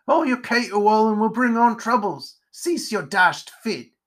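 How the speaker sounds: fed-up character telling-off; exasperated spider character. Acted. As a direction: order an end to the racket. Spider scolds the raving pumpkinhead.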